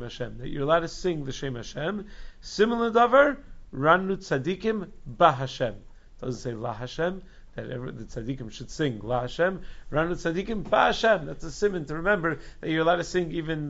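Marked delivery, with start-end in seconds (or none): 2.4-5.7 raised, louder ringing out of the mnemonic; emphatic citation. Shown as a demonstration. Contrasts Ba with La Hashem. 9.9-11.3 emphatic correction landing on "Ba"; stress on BA. Shown as a demonstration. Corrects La to Ba Hashem.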